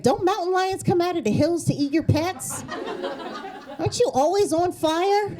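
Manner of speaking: high-pitched, needling questions; mock incredulous. Mock questions to West Coast friends.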